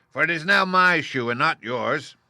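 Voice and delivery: high, loud cackling witch voice; witch's retort. A narrator's reading. Witch retorting to Dorothy, high and loud. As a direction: refuse her flatly, a witch with her prize. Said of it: "retorted the witch".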